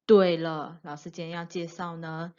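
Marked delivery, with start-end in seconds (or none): none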